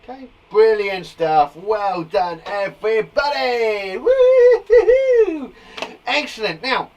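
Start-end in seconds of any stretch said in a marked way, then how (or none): 0.5-4.0 loud, slow, drawn-out congratulation; cheering praise. Real, not acted. Teacher praising everybody for the work, cheering. 4.0-5.5 long, high, loud woo-hoo; whooping cheer. Real, not acted. Teacher celebrating with the class, whooping.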